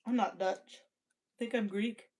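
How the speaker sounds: ordinary delivery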